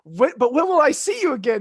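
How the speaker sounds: high, loud mock plea; hammed-up suitor calling after her; pleading Philip. Philip as Aurora says goodbye, hammed-up urgent plea. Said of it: "And he goes".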